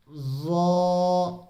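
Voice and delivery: ज़ो drawn out slowly, heavy and rounded; full-mouthed zo. Shown as a demonstration. Teacher to viewers, a long heavy ज़ो. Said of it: "इसको भी पुर करके पढ़ना है".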